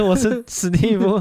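pinched-nose voice, laughing through it; nose held, giggling; pinching nose. Acted. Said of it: "我来捏着鼻子讲话".

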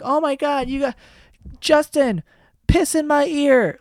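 high, mocking fan squeal, slowing on the last words; mock fan gushing. Mocking starstruck kids in the comments, high squeal. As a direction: gush like a starstruck kid fan.

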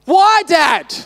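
mock yell of a protesting child; imitated child's yell. Six-year-old son yelling at dad in the kitchen, imitated yell. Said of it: "standing in the kitchen just yelling at me".

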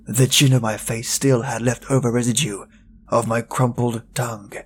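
plain reading voice

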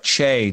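"Che" said with a hard CH; misread name. Retold or imitated. Guessing how people misread her, "Che" as CHAY. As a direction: say "Che" as CHAY, not SHAY. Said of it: "you get a lot of".

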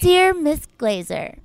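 slow, high, stilted affected reading. Acted. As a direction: read fan mail slowly in a high, put-on voice. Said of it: "You're gonna do the [name]?".

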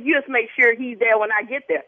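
ordinary delivery

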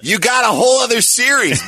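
high, incredulous holler; mock exasperated shout. Telling the doubting actor he got a series, exasperated. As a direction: yell it in comic disbelief.

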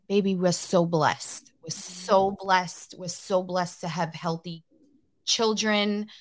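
ordinary talk

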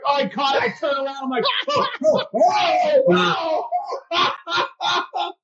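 rising, excited cries of oh; reliving the moment he saw it caught; shocked disbelief. Retold or imitated. Pitcher retelling teammates his stunned oh, oh.

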